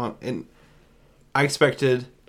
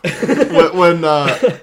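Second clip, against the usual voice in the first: loud, higher, laughing false start; cracking up; 7 semitones higher, 12 dB louder, much slower than usual. Real, not acted. Co-host recalling a hilarious gory scene, cracking up. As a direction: try to start through real laughter.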